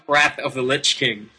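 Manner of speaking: ordinary talk, nothing marked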